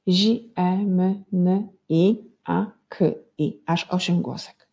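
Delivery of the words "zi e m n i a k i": each sound of ziemniaki on its own. Shown as a demonstration. Teacher divides a picture name into sounds, each one separate. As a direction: sound out zi, e, m, n, i, a, k, i with gaps. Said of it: "i na głoski".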